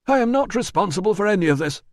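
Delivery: high, fast, loud denial; insisting. Acted. Manager insists on his innocence, high and fast. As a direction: deny it in a rush. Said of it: "he insisted".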